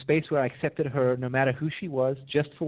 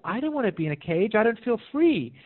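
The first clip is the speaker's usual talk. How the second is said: higher-pitched mock complaint, no real sobs; lightly voiced unhappy bird; upset little bird; 8 semitones higher than usual. Caged little bird pleading with its keeper, mildly acted upset. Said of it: "the little bird starts crying".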